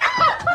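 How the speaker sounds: short, high-pitched burst of laughter; sudden high giggle. Real, not acted. Reacting to the parrot's joke.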